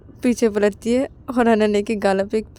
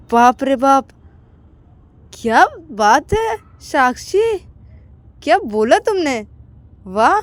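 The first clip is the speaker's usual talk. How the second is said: higher, slowed admiring exclaim; impressed friend; 6 semitones higher, 5 dB louder, slower than usual. Acted. Aakash praising Sakshi, impressed and higher. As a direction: cheer her on in wonder.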